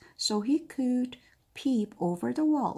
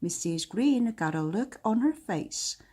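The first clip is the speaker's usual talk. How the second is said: usual speaking voice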